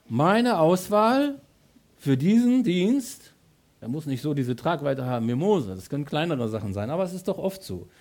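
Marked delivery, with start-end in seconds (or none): 0.1-3.3 very slow, higher, louder words of God; slow divine voice. Acted. God announcing his choice to the believer, very slow and higher. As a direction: weigh each word as God choosing you. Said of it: "Gott sagt".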